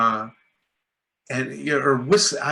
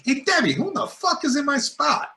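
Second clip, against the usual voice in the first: playful mock outrage; 13 semitones higher than usual. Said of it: "I'm playing around".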